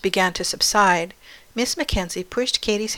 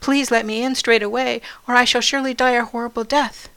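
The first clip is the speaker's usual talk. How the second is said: higher, hurried pleading; urgent plea; 5 semitones higher, 4 dB louder than usual. A narrator's reading. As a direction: beg desperately to be let in. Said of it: "as if to say".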